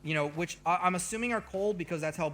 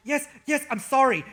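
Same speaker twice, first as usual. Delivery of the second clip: apologetic rush; 6 semitones higher, 7 dB louder than usual. Pretend. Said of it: "he says as he goes off".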